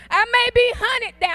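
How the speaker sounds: high, loud burst of defiant attitude. Shown as a demonstration. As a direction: hit it high and hard, full of attitude. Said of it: "Gotta get a little attitude with it".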